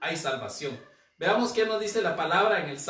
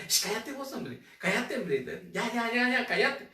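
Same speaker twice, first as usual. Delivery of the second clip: mock shushing, half laughing; the crowd telling the beggar to shut up; hushing; 6 semitones higher than usual. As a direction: shush him dismissively. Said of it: "Me imagino, decían".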